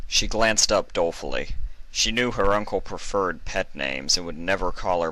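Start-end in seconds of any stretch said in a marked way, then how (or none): none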